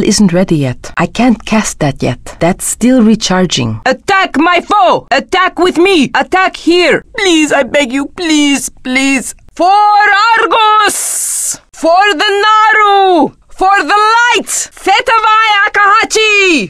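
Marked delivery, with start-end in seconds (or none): 3.8-7.0 high-pitched rallying call to attack; battle command. Acted. Warrior calling allies to attack the foe, urgent high command. 7.2-9.3 pleading. Acted. Character begging someone, high plea trailing away. 9.5-16.7 high, drawn-out war cry; battle cry. Acted. Warrior charging for the Light, high shouted battle cry.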